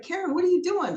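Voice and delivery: retold baffled question; puzzled partner. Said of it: "And he's like".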